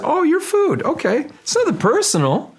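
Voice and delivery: very high, casual shark voice; voicing the shark. The shark tells the splashing swimmer, in a high, breezy voice. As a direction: play the shark, shrugging off the meal. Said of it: "He's saying, he said".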